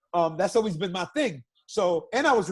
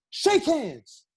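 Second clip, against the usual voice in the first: high mock bark of an order; barked command; 9 semitones higher than usual. [name] barks at the two fighters, high mock bark. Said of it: "he just started barking".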